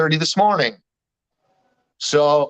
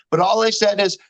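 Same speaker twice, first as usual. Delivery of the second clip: ordinary talk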